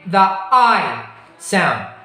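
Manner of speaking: slow "the I sound", the I stretched into a long glide; drawn-out vowel demo. Shown as a demonstration. Teacher presenting the diphthong to the class, slow and drawn out. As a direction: stretch "I" slowly so the diphthong is heard. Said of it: "the E sound and also".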